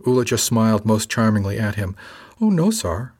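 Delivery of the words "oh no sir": put-on higher little-girl voice; wispy little girl. A narrator's reading. Crew member charmingly answers her superior, wispy little-girl voice. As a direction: play innocent in a high, wispy girlish voice. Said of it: "in that wispy little girl voice".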